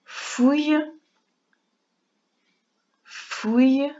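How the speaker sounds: fouille said clearly twice; dictation sounding out. Shown as a demonstration.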